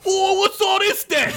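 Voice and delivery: high comic orc holler; a hammed-up orc calling out; yelling orc. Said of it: "yelled one orc".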